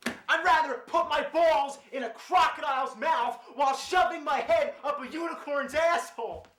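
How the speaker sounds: high shouted cartoon rant; raging gamer.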